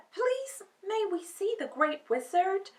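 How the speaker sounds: put-on high, young girl's polite request; Dorothy's voice. A narrator's reading. Dorothy politely asks the gatekeeper, high and sweet. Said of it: "asked Dorothy".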